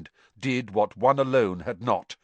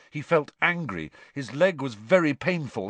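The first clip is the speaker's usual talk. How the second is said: plain reading voice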